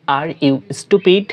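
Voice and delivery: drawn-out model question; teacher repeating slowly; slow demo. Shown as a demonstration. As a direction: say it slowly so students copy. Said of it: "বলার ক্ষেত্রে বলবো".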